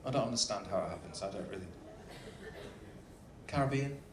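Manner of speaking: usual speaking voice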